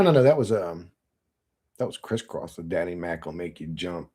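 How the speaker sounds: ordinary talk, nothing marked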